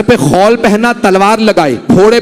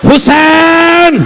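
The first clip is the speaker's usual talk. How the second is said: drawn-out lament; 4 semitones higher, much slower than usual. Acted. Lament, no one to say it.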